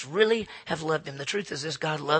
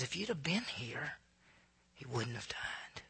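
very soft retold plea, dropping to a whisper; hushed lament; 4 semitones lower, 12 dB quieter than usual. Grieving sister falls before Jesus, hushed. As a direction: let it sink low and slow on the last words.

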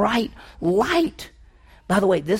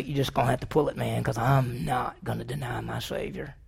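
quiet resolve; 6 semitones lower, 8 dB quieter than usual. Pretend. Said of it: "we'd say".

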